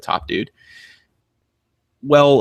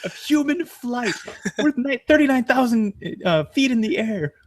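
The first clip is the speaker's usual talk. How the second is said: much higher, incredulous comedian rant; Louis C K rant; 10 semitones higher than usual. Retold or imitated. Continuing the Louis C K airplane bit, incredulous. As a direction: act out the stand-up bit, aghast. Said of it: "that Louis C K skit".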